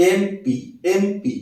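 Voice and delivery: এমপি said very slowly, opening on a clear এ sound; slow pronunciation demo. Shown as a demonstration. Teacher to students, showing the এ sound in এমপি, drawn out slowly. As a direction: stretch out এমপি so the এ is heard. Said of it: "উচ্চারণ করতে গেলে আমার এ চলে আসতেছে".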